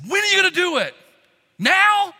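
loud, high, bursting with anticipation; eager shouted question. Acted. Disciples asking Jesus when he will do it, eager. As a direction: demand it at the top of your excitement.

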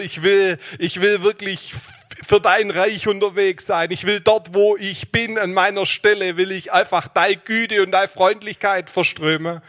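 high, loud, pleading prayer at full force; fervent loud prayer. Acted. Preacher praying aloud before his church, fervent and loud. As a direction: pour out the prayer to God with fervour.

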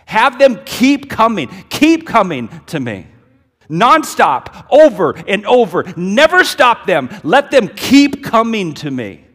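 loud, high indignant command; indignant Jesus. Acted. Jesus rebuking disciples, loud and indignant. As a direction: insist furiously the kids come through. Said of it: "he's indignant. He's angry".

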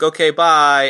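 loud, high sign-off; mock hasty goodbye. Co-host mocking cop leaving King Willie, loud high goodbye. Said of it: "Harrigan's like".

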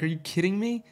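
high incredulous friend voice. Retold or imitated. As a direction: mimic the friend's disbelief.

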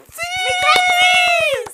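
slow, high, loud announcer call; announcer shout. Acted. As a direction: call the name like a game show host. Announcing the biggest fan.

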